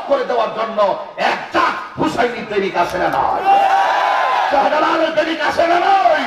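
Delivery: rally the crowd at full force; preaching shout. Real, not acted.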